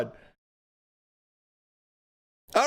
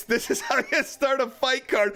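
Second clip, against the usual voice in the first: usual speaking voice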